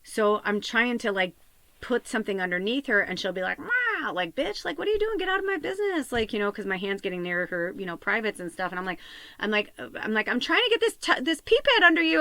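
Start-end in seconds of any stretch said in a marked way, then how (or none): 3.6-6.1 high mock-offended squeak for the dog; indignant dog voice. Owner voicing her old dog's sassy protest, high mock-offended voice. 10.3-12.2 higher, louder frustrated pleading; exasperated plea. Retold or imitated. To the dog while sliding a pee pad under her, exasperated and loud.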